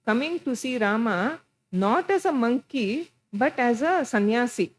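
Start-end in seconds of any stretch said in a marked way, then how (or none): none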